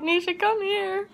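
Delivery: high, slow call across the house. Retold or imitated. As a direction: call her sister from the bathroom. Calling sister from bathroom.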